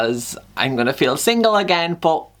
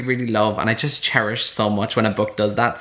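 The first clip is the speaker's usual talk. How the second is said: ordinary talk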